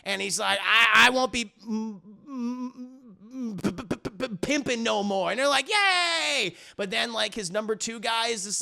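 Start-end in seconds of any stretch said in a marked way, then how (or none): 0.6-5.3 imitated heavy stutter on m and p; stammering. Pimp vows to quit before choir, stammering. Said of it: "he has, like, a stutter". 5.7-6.5 high, drawn-out mock cheer. Choir celebrates the pimp's vow, mock cheering.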